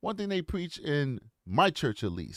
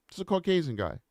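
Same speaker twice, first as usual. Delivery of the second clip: normal talking voice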